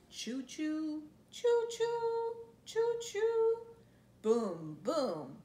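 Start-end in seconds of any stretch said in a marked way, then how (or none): none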